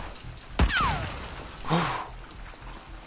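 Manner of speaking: sharp high frightened gasp; startled gasp. Real, not acted. Sudden scare in the game.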